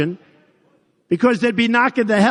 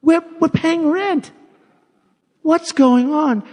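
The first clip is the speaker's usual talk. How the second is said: slow disbelief; 9 semitones higher, slower than usual. Real, not acted. Outrage that the US pays rent on bases.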